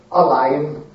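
plain reading voice

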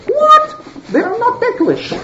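high-pitched, incredulous discovery; acted shock, pitch leaping up; astonished. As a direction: react in squeaky disbelief. Acted surprise at unresponsive idols.

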